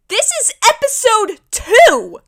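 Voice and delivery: high, loud, drawn-out protest; incredulous yell. Acted. Fan appalled it is only episode two, incredulous. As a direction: shout it slowly in disbelief. Exasperated shout at how dark it is.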